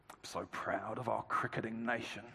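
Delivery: very quiet, low imitation of a solemn Australian speech; hushed, leaning into microphone. Cricket captain to a silent dinner crowd, hushed and low. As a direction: drop right down, close to the mic. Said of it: "He leaned into his microphone".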